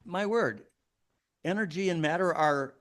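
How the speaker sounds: usual speaking voice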